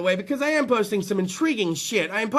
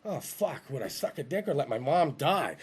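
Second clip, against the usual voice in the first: quiet dread; 10 semitones lower, 11 dB quieter than usual. Retold or imitated. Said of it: "I'm sitting there going".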